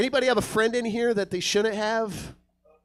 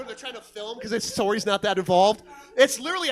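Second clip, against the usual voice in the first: normal talking voice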